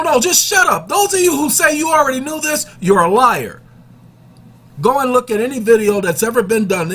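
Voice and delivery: ordinary delivery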